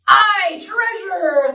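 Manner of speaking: loud, high pirate crew cheer; shouting. Acted. Crew cheers the chest of treasure, shouting. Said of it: "the others shouted".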